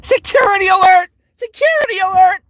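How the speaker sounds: very high, loud cartoon alarm; high alarm cry. Performing a character for stream viewers, high loud alert. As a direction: blare the warning in a piercing high voice. Acting Sun's game catchphrase on request.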